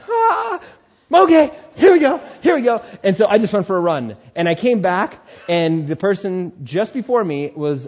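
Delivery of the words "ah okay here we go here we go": high, loud nervous here we go; nervous psyching up. Retold or imitated. Kicked out of the nest, a jittery loud here we go. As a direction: psych yourself up, squeaking with nerves. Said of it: "I'm just like".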